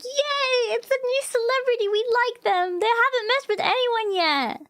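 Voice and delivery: high, gushing fan voice, imitating the crowd; mock-cheer like excited fans over a new star. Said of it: "So everyone's like".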